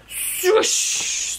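long unvoiced swish; whooshing air. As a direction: imitate air rushing out of a balloon. Imitates balloon losing air.

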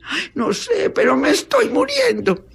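hammed-up deathbed cry; dying wail. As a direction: wail like a stricken patient. Sick woman answers, convulsed, dying.

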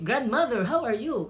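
high, lilting English in Argentinian style; Argentinian accent. Shown as a demonstration. Teacher translating the greeting to grandmother, sing-song Argentinian accent. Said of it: "lagi nang pataas".

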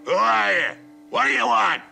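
loud and high, alarmed; shouting back. Acted. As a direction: yell back at the stranger.

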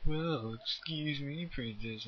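lightly mocked cartoon hero; mocking quote. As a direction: toss off the cartoon line mockingly. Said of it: "he ain't saying that damn shit".

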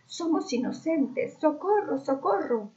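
high, slow hammed-up cries for help; mock terrified cry. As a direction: wail it out like bad theatre. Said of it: "gritó Camila".

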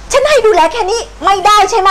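grandma furiously scolding the cat; furious rebuke. Acted. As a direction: tear into the disobedient cat.

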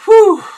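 sharp high exhale; loud high whew. Real, not acted. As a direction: blow out the sourness. Sour candy reaction.

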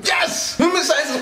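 high shouted yes; victory cheer. Real, not acted.

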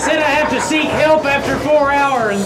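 loud, high call over the noise. Real, not acted. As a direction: shout to be heard in the haunt.

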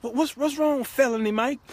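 high-pitched mock concern; concerned girlfriend. Girlfriend asking crying felony Mike, high mock concern. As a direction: fuss in a put-on high voice.

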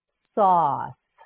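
"Sauce" loud with a long vowel; slow modelling. Shown as a demonstration. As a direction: stretch "Sauce" out firmly. Teacher models word slowly.

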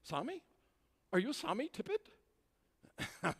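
put-on tentative German-accented query; imitated German accent. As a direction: mimic a hesitant German asking who someone is. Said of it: "I heard this German boy say".

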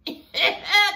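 quick high burst of laughter. Real, not acted. Host laughs at the wig threat, high laugh. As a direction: let a laugh pop out.

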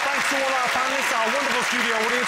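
game show host thanking panel and viewers, upbeat; host sign-off. Acted. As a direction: wrap up the show for the audience at home.

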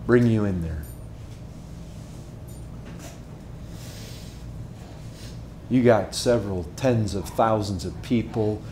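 low, soft, drawn-in preaching voice; softly telling the arena scene to the congregation; hushed storytelling. Acted. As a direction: pull them in close with a low, soft voice.

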